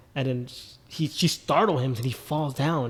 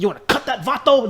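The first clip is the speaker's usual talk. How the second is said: higher, tough Chicano-tinged imitation; Danny Trejo line; 8 semitones higher, 4 dB louder than usual. Retelling Danny Trejo's line to Jimmy, mock tough accent. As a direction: lightly imitate a tough guy offering help. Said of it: "he said the way he said it".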